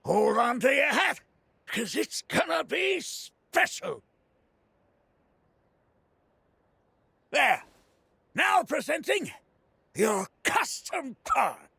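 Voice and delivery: high, drawn-out grand unveiling; showman's build-up. Acted. Part maker unveiling a custom part to customer, showman's build-up. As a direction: announce it like a big reveal, savouring each phrase.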